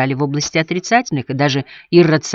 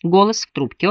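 usual speaking voice

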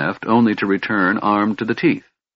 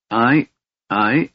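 stammering; 2 semitones higher than usual. A narrator's reading. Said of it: "the Kender stammered".

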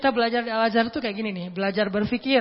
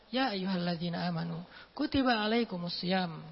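relaxed recitation; 4 semitones lower, 5 dB quieter than usual. Shown as a demonstration. Preacher showing the relaxed way, soft and calm. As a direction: recite it calmly and quietly. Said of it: "santai turunnya".

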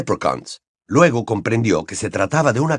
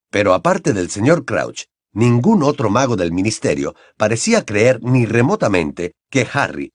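ordinary delivery

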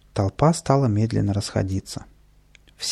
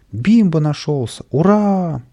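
higher cheer building to hurrah; joyful shouting; 7 semitones higher, 5 dB louder, slower than usual. A narrator's reading. Crowd greeting the found talking dog, joyful shout. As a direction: cheer with delight. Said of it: "радостно закричали".

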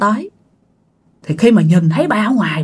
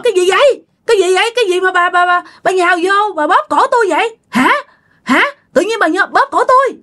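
high-pitched bewildered protest; acted indignant anger; 8 semitones higher than usual. Dad protesting being choked for no reason, indignant. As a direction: demand to know why you were attacked. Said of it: "ba rất là tức".

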